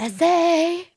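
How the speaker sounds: badly faked Spanish accent; attempted Spanish accent. Shown as a demonstration. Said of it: "So I was trying to do".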